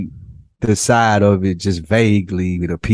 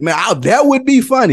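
excited. Real, not acted. Laughing at the joke.

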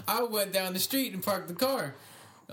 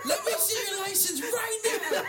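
mock yell. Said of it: "his wife is yelling at me".

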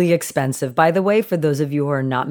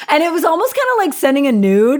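high, fast, laughing through the words; giggling at her own joke; 10 semitones higher, 4 dB louder, faster than usual. Real, not acted. Host laughing as she compares mango photos to nudes.

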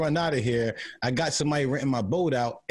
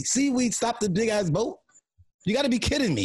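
incredulous; 6 semitones higher than usual. Real, not acted. Incredulous about boat story.

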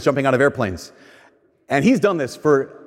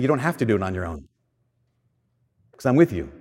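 lower, softer reassuring voice; a soft, lower, reassuring promise; gentle Jesus; 6 semitones lower, 4 dB quieter than usual. Acted. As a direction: speak low and softly as Jesus comforting.

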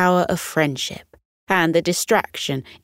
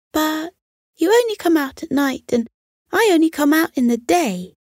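higher, quicker, a bit sad; a little sadly; 10 semitones higher, faster than usual. A narrator's reading. Squirrel telling the fox their hours never meet, a little sadly. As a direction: say it fast, with gentle regret. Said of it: "he said a little bit sadly".

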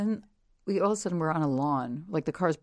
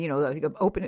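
ordinary talk, nothing marked

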